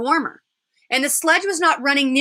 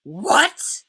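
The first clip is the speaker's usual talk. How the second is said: mild mock roar; 6 semitones higher than usual. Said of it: "roared the witch".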